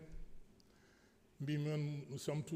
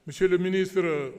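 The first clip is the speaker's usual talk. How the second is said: loud address; 3 semitones higher, 11 dB louder than usual. Real, not acted. Addresses minister loudly.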